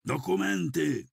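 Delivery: high-pitched barked order; a narrator's restrained roar, voice leaping high. A narrator's reading. Militiamen beating a frail student for his papers, roaring.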